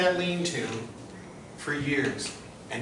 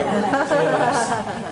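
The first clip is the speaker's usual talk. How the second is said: laughing; 7 semitones higher, 5 dB louder, much slower than usual. Real, not acted. Joke about hidden pool.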